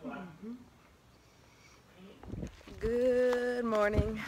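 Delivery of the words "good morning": slow, stretched good morning; drawn-out greeting. Real, not acted. Vlogger opens a new day, drawn-out greeting. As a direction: stretch the vowels out in a long hello.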